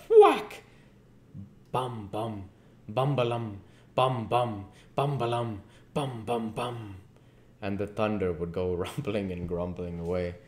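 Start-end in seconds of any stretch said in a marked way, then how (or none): 0.1-0.6 excited whack. A narrator's reading. Storm narration, laugh. 1.7-7.0 chanted thunder booms, fading away; rolling thunder imitation. A narrator's reading. Narrator imitating the thunder, chanted and fading.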